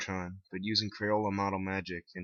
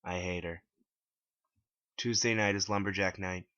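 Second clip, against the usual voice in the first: usual speaking voice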